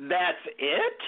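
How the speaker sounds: high-pitched, mock-outraged disbelief; incredulous protest. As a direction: question it as if badly short-changed. Acted outburst of a let-down traveler.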